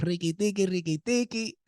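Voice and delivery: high-pitched mock-Mexican patter; playful Cinco de Mayo accent. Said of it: "Cinco de Mayo starting to come out".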